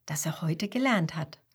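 usual speaking voice

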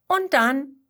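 plain reading voice

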